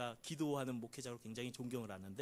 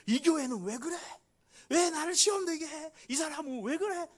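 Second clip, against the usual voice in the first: higher, louder indignant gripe; complaining whine; 12 semitones higher, 7 dB louder than usual. Retold or imitated. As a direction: grumble about the church, indignant. Grumbling church member quoted. (높고 크게 투덜대는 불평)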